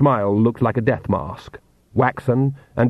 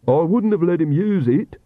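higher-pitched character voice; apologetic Piotr; 6 semitones higher than usual. Acted. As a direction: explain yourself in a raised voice pitch.

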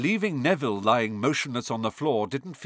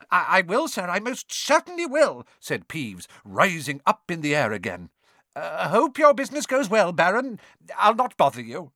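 flustered poltergeist; 6 semitones higher, 4 dB louder than usual. A narrator's reading. Peeves grovels to the Baron.

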